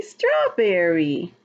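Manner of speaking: usual speaking voice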